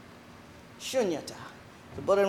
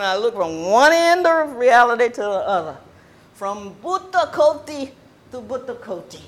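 rising into loud Southern preaching; Southern preacher voice; 8 dB louder than usual. Acted. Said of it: "all this southern accent was about to come".

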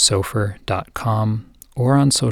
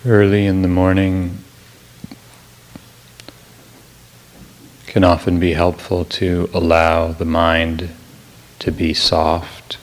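guided meditation; 2 semitones lower than usual. Real, not acted. Teacher easing a listener into the morning, calm guided meditation. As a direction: guide gently, unhurried, phrase by phrase.

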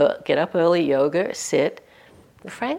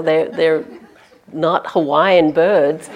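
ordinary talk

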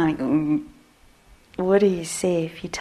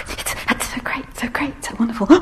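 higher, gushing overexcited voice; mock giddy excitement; agitated excitement; 4 semitones higher, faster than usual. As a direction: gush like you can't contain it. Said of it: "agitated excitement".

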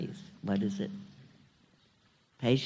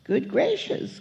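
high-pitched mock astonishment; acted surprise, voice jumping up; storybook gasp; 10 semitones higher, slower than usual. A narrator's reading. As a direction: exclaim it as a startled bird in a children's tale. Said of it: "gasped Maisie".

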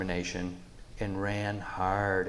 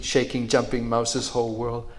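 ordinary delivery

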